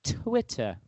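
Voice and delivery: low imitation English "Twit-tah"; English accent. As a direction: say Twitter like an English person, crisp t, no r. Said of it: "English people when they say Twitter".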